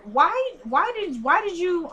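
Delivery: high, drawn-out swooping whys; acted exasperated demand. Veronica demanding answers from David, acted exasperation. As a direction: stretch each why up high, as if pleading for answers.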